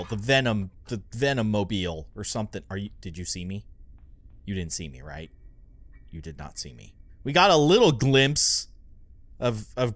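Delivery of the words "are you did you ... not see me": soft, low nervous murmur to the monster; hushed while hiding from Granny. Real, not acted. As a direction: keep it down so she won't find you.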